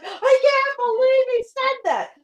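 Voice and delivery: high, loud pretend outrage; mock scandalised scream. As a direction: shriek it like a scandalised onlooker. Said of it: "women would be screaming".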